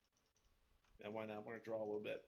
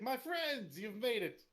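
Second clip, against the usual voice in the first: high-pitched, exuberant welcome as Volo; theatrical, over-friendly host greeting; effusive greeting; 10 semitones higher, slower than usual. Acted. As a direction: hail old friends grandly, pitch swooping up. Game master voices Volo's greeting.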